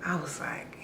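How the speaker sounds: whispered lead-in to the punchline; whispered aside. Real, not acted. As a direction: drop to a whisper.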